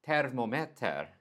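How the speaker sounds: "thermometer." as Swedish "tär-mo-meh-tär"; Swedish pronunciation. Shown as a demonstration. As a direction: give thermometer its Swedish vowels and tone. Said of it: "the way to say it in Swedish is".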